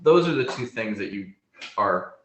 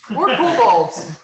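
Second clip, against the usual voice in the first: high mocking voice; 10 dB louder, slower than usual. Dragon mocks kobolds.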